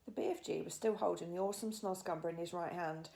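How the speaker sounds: ordinary delivery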